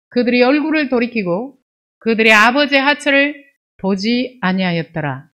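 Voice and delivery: emphatic, measured verse reading; scripture reading. Acted. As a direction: read the verse out firmly to the congregation. Reads scripture aloud.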